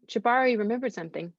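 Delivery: plain reading voice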